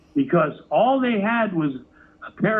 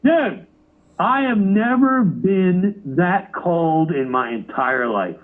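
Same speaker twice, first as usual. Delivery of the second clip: normal talking voice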